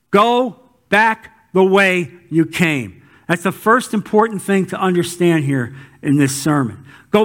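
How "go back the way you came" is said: slower and firmer on the echo; slow repeat. Acted. Preacher repeating God's command to the congregation, slow emphatic echo. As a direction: drive it home slowly.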